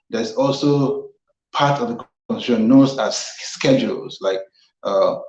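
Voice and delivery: ordinary delivery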